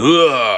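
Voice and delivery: loud jeering whoop; a sudden loud taunting cry; mocking yell. Guard jeering loudly at the angry tree house owner, taunting whoop.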